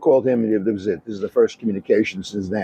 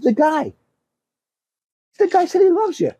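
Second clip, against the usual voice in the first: mock outrage. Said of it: "he got mad at me".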